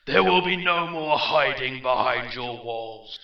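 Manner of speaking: full echoing battle shout; shouting with wild adrenaline. Acted. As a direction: roar commands like a crazed warlord. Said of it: "Arthas was shouting now".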